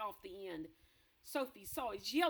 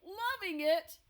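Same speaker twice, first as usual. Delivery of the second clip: loud, high incredulous yell; outraged yell; 10 semitones higher, 11 dB louder, slower than usual. Acted. Blood Bottler outraged at the BFG, loud yell. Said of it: "yelled the Blood Bottler".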